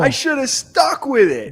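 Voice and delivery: high, drawn-out wail of regret; anguished groan. Real, not acted. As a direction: lament the missed pick, slow and high. Groaning regret after a bad pick.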